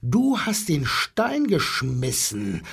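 plain reading voice

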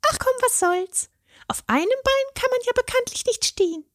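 very high fairy voice, joking; cheerful fairy. A narrator's reading. Fairy jokes with the troll, high and cheerful. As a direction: hand over a spare with a wink. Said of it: "sagte sie".

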